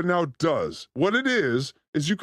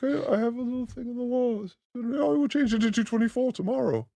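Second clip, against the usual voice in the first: higher, quick self-parody; mock obsessed fan; 5 semitones higher than usual. Quoting own reply about countdown, higher giddy voice. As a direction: put on an eager little voice.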